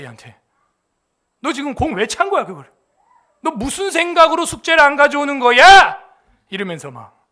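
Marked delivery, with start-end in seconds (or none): 1.4-6.0 louder, higher scolding that builds; imitating coach's scolding. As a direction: grill the kid, building in volume. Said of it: "호랑이가 되는 거야".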